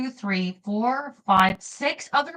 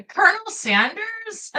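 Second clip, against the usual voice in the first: much higher, amused question; amused surprise; 12 semitones higher, 3 dB louder than usual. Real, not acted. Realizing she means to voice Colonel Sanders, amused high disbelief. Amused at her own idea.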